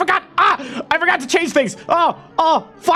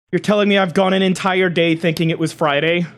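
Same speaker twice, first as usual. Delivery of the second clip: ordinary delivery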